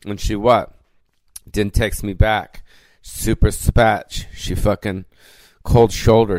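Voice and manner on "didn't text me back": usual speaking voice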